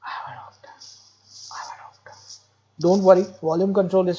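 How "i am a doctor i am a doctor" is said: soft, low whisper, same pitch throughout; monotonous soft voice. Shown as a demonstration. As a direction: whisper it flat like a Parkinson patient. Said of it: "This patient is going to spell the same thing like this".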